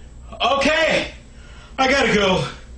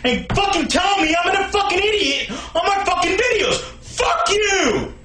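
angry rant. Acted. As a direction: yell furiously at the haters. Ranting at the haters in comments.

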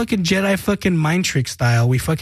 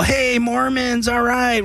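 higher, slow cheer "hey Mormons"; drawn-out mock greeting; 6 semitones higher, much slower than usual. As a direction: stretch it out like a friendly shout-out. Band greets them by nickname.

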